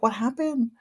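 high-pitched, curious question; excited child's voice. Retold or imitated. Nephew wondering why aunt would apologize, excited and high. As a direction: ask high like a puzzled, eager kid. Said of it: "he still was so excited".

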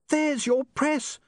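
high, slow, drawn-out cry; high-pitched acted shout. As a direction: call it out high and urgently. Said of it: "she shouted".

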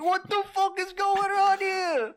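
high, loud, slow hammed-up Italian-American yell; mock Italian guy. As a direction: blow up like a lost paisano. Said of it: "An Italian guy goes to New Guinea and he's like".

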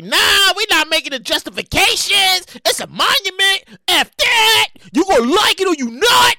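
hammed-up artist brushing off critics; paraphrased artist. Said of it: "he ain't say it like that. I'm just paraphrasing".

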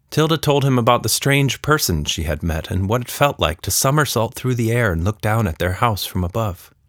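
ordinary delivery, nothing marked

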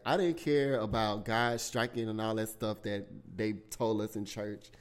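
ordinary delivery

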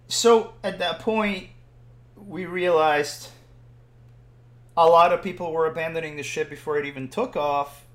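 usual speaking voice

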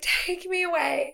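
high, slow melodramatic begging; mock dramatic plea. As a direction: plead like a drama queen, drawn out. Friend acts out dramatic plea.

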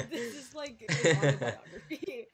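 slow, laughing joke. Real, not acted. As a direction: laugh through the words. Joking through laughter.

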